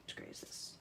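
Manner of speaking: soft unvoiced whisper to self; hushed private aside; whispered to herself. Real, not acted. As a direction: whisper it like thinking aloud. Muttering to herself while choosing.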